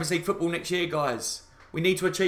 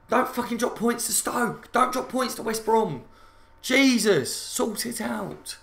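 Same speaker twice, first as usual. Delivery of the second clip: exasperated plea; 5 semitones higher, slower than usual. Real, not acted.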